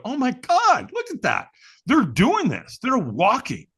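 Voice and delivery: high-pitched awestruck discovery; amazed. Retold or imitated. Seeing thousands of people firewalking, amazed.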